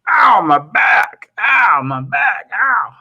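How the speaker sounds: hammed-up pained cry. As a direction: ham up a beggar clutching his back. Imitating beggar's back pain.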